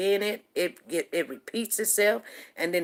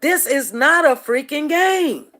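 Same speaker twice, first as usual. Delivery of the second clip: higher, louder and slow, fed up; exasperated; 7 semitones higher, 8 dB louder, slower than usual. Retold or imitated. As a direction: spell it out in disbelief. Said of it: "And I'm like".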